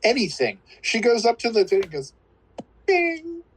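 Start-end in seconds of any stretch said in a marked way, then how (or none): 2.9-3.4 high sung piano ping. Retold or imitated. Imitating piano note again.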